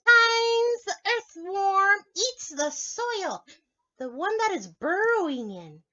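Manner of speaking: squeaky eager spider; playful spider puppet for kids; friendly spider puppet. Acted.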